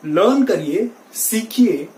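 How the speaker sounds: ordinary delivery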